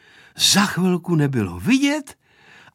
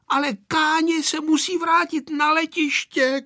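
high-pitched shout, volume held back; narrated yell, carried by pitch; boy shouting; 13 semitones higher than usual. A narrator's reading. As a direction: cry out in a high, insistent voice. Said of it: "křičel Vojta, až se třásly tabulky".

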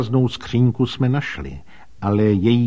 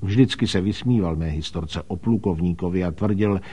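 plain reading voice

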